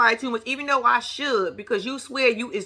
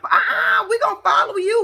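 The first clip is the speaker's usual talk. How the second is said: high, loud mock shout; loud refusal; 11 semitones higher, 5 dB louder than usual. Camera crew shouting back at Martell, mock shout. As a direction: shout them down cheekily.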